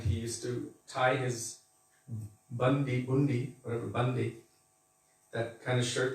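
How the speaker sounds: ordinary delivery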